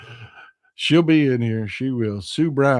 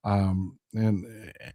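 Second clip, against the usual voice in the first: ordinary talk, nothing marked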